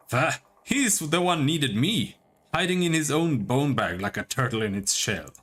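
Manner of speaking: a light, rough Scottish brogue; gruff Scottish taunt, only lightly put on; mock-tough Scottish voice; Scottish accent. Isilmir speaks through Aloth.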